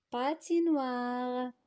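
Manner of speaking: "patinoire" drawn out as one word; slow completed word. Shown as a demonstration. Teacher gives pupils the completed noun, slow and drawn out. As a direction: stretch "patinoire" slowly, final re sounded. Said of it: "les compléter par les syllabes".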